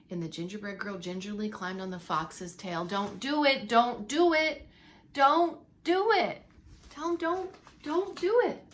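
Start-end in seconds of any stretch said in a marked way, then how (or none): 2.9-8.6 louder, rising, slower pleading; storyteller breaking in to warn; urgent warning. Real, not acted. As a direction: beg the character not to. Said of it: "reader warns the character".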